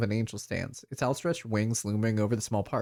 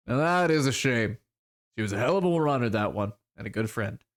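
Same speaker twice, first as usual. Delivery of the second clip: rueful troll character. Acted. Troll mourning Monica the runner, mildly rueful. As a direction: let the regret drag a little.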